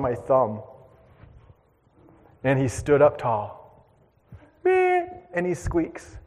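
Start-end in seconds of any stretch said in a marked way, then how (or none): 4.6-5.1 very high, slow little squeak; imitated gopher squeak. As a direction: squeak like a tiny rodent.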